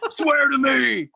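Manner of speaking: slow, loud, high-strained superhero impression. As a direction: drag it out in a mock vigilante shout.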